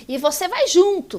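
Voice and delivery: retold loud order. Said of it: "falou pra mim".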